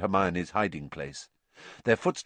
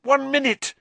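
much higher, loud urgent cry; urgent countdown; 5 dB louder than usual. A narrator's reading. Hermione checking her watch, crying the last minute.

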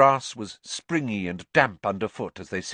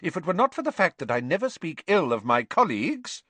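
higher-pitched, indignant teacher; restrained indignation; 9 semitones higher, faster than usual. A narrator's reading. Teacher breaking off criticism of a colleague, restrained indignation. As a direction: hold back your disapproval in a high voice. McGonagall bristling about a colleague.

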